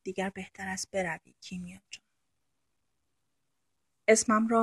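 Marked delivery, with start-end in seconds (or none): 0.0-2.0 much softer, a choked hoarse voice. A narrator's reading. Shams sends Kimia away after touching her lip, softly hoarse. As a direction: say it hoarsely, holding back. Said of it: "با صدایی گرفته گفت".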